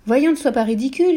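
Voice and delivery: voix d'épouse plus aiguë et plus forte, qui gronde; épouse agacée. A narrator's reading. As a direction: gronde ton mari comme une sotte idée. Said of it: "lui dit sa femme". (une femme sermonne son mari pour le chapeau, agacée)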